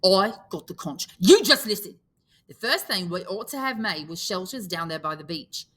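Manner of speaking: put-on loud, angry boy's outburst; telling off the whole group, voice up; lost his temper. Piggy scolding the boys for running off, loud and angry. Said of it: "Piggy lost his temper".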